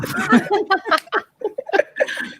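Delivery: a burst of high giggling; giggle, caught off guard; high embarrassed giggle. Real, not acted. Embarrassed at being called on.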